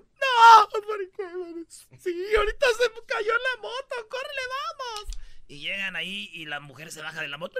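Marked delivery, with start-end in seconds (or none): 0.0-5.2 high, frantic mock wail; hammed-up panic over a crash; wife hearing of the crash, in a high mock panicked wail; panicked crying. Wife panicking, voiced in story.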